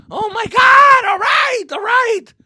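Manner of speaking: very high, loud frantic yelp; panicked giving in. Retold or imitated. Kid giving in to his dad, high panicked shout. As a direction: cave in fast, terrified.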